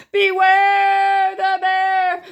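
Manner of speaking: loud, high, very slow shout; booming slow bear shout; shouted bear call. A narrator's reading. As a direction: bellow it out as the bear arrives. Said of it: "And closer".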